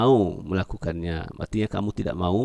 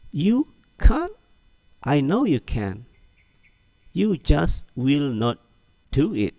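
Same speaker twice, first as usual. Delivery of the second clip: high, teasing disbelief; slow, for repeating; high, slowed teasing reply for the class; model reading; 7 semitones higher, slower than usual. Shown as a demonstration. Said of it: "Ulangi lagi".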